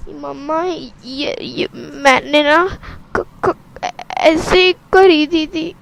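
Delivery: put-on slow, halting, frightened child; girl stammers her excuse to her mother; scared stammer.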